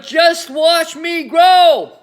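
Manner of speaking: slow, high, loud drawn-out cry; grandma's slow gleeful bellow; triumphant shout. Acted. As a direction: stretch every word as she soars upward. Grandma shouting as she grows.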